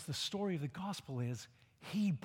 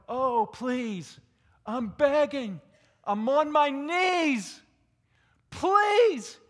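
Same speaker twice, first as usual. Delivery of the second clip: louder and higher, pleading on his knees; desperate begging; 7 semitones higher, 10 dB louder, much slower than usual. Retold or imitated. A man on his knees begs loudly for mercy. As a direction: beg loudly, climbing in pitch. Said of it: "the man down here going".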